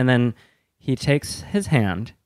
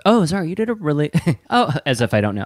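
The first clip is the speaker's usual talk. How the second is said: 1 mock gushing; 8 semitones higher, faster than usual. Mock-gushing praise at Zara.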